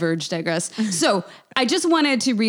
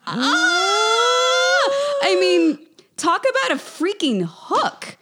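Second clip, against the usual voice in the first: excited shout; much slower than usual. Real, not acted. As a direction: gasp and yell with delight. Excited outburst about the book's hook.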